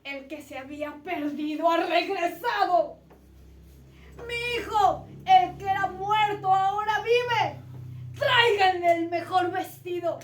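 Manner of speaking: loud, high, overjoyed calling out; a father bellowing his delight at his son's return; father's joyful shouts. Acted. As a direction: shout it to the whole house, wild with joy. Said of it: "con los gritos del padre a la familia".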